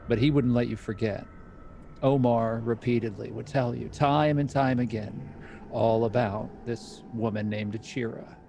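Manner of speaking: ordinary talk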